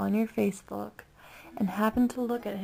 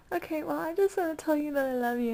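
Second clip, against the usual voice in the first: gushing fan; 7 semitones higher, faster than usual. Retold or imitated. A fan telling Craig she loves him, giddy and higher. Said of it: "I was like".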